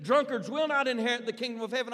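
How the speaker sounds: high, loud fiery preacher's thunder; preaching like a maniac. Retold or imitated. Evangelist warning the crowd, a loud fiery preacher's cry. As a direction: hammer the sinners from the pulpit. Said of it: "began to preach like a maniac".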